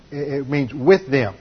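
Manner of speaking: slow, with "with" drawn out and stressed; slowly, pressing on "with". Shown as a demonstration. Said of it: "it indicates talking with them".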